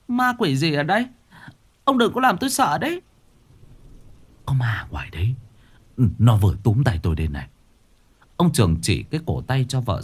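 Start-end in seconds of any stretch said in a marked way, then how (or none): 0.1-3.0 high, fast frightened wife voice; stammering fear. Acted. Frightened wife begging her husband not to scare her. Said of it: "Bà lắp bắp". 4.5-7.5 hushed then fast, scared; frightened whisper. Acted. Scared husband telling his wife about the ghost.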